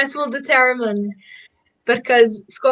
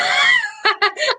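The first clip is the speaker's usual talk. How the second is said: high squealing laugh. Real, not acted. Laughing at question.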